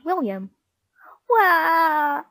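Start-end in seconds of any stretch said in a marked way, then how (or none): 1.3-2.2 loud, high crying wail; high, tearful story-character howl; Weepy William's wail. A narrator's reading. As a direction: wail "wah" like a crybaby. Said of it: "wailed Weepy William".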